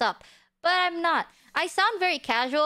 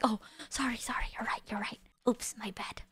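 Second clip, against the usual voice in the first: much softer, whispered apology; sheepish whisper; 3 semitones lower, 9 dB quieter than usual. Retold or imitated. Streamer replaying apologizing to the fan, sheepish whisper. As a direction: whisper sorry after being shushed. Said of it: "And I was like".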